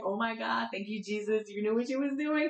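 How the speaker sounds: retold praise, pitch climbing; grateful relief. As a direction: thank heaven with rising delight. Retold thanks for dance class.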